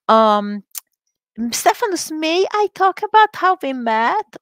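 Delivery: normal talking voice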